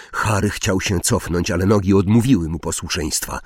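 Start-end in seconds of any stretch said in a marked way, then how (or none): none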